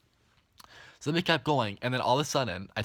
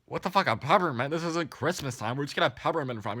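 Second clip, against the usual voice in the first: comic what-on-earth indignation, pitched up; mock disbelief; 4 semitones higher than usual. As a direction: act out baffled outrage. Retold disbelief at offered peppermint.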